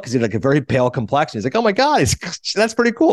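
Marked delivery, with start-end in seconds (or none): 1.6-3.1 high voice breaking into a laugh; amused retold delight. Host acting Marty meeting an old acquaintance, amused delight. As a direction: imitate delighted surprise, laughing through it.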